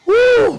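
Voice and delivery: high, short exuberant "woo"; sudden high exclamation; whoop. Real, not acted. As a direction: let out a quick high whoop. Preacher whoops between remarks.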